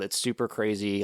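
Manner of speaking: ordinary talk, nothing marked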